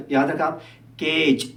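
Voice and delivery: ordinary talk, nothing marked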